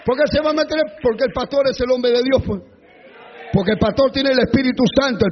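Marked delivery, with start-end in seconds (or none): 0.1-1.0 higher, fast, loud mock objection; husband objecting. Pastor imitating a resentful husband, loud and fast. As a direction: spit it out indignant. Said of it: "Porque dice".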